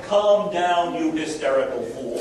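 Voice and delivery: imitating a patronising official telling a crowd to calm down; condescending tone. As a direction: talk down to them like a fool. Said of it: "what your tone of voice says".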